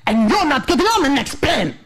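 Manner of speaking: high, hammed-up spiteful announcement; single someone out with mock venom; exaggerated impression of a mean airline agent; hostile airline man. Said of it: "he just goes".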